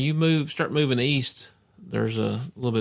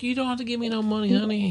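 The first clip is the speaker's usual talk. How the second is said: much higher, fast sweet mock lady voice; imitating the lady; 10 semitones higher, faster than usual. Smitten lady turning down the hunter's money, high fast mock voice. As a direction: coo it like a smitten Southern lady.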